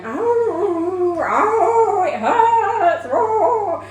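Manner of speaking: high, loud drawn-out moans of pain; imitating a moaning woman. Mother imitating the moaning new mother in the ward, high loud moans. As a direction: mimic the woman in the next bed groaning. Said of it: "she just lay there going".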